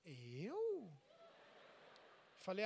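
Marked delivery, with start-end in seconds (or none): 0.0-0.9 small, soft drawn-out question; imitated innocent wife. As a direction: feign innocence softly. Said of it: "Ela falou".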